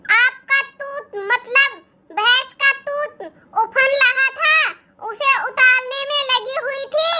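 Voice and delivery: put-on "तूत" with a t in place of d, childish lisp; lisping baby talk. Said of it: "तुझ जैसी तोतली बहू".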